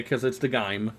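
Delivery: ordinary delivery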